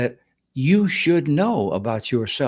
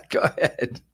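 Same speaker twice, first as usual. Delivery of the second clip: laughing; 4 semitones higher than usual. Real, not acted. Laughs after teasing remark.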